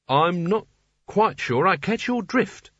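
sickly, halting puzzlement; murmured. A narrator's reading. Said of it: "murmured Rincewind, his face ghastly with sweat".

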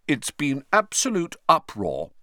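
self-important pompous tone. Acted. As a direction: sound grandly self-important. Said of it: "Percy told them importantly".

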